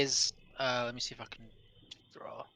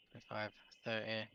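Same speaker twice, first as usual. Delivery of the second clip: normal talking voice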